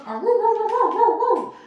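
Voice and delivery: high, loud woo woo barks like a dog; dog barking. Pretend. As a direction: bark it out so it sounds real. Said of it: "I had to bark to make it sound real".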